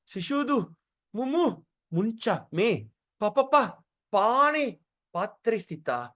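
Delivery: halting verse with "Kr" stuck before "Krishna"; drunkenly stuttered "pa, pa" in the chanted verse; intoxicated stammer. Acted. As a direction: stumble through "mumu, muncha" and "pa, pa, pane". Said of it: "that means he's so intoxicated".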